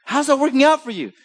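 a light mock challenge; wry question. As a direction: tease him with the question. Voicing Jesus again, higher.

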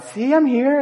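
put-on high, chirpy kid voice announcing arrival; mock proud kid. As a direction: playfully imitate his son showing up.

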